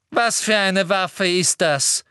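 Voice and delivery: slow, brusque Umbridge; curt. A narrator's reading. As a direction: demand an answer. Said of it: "fragte Umbridge schroff".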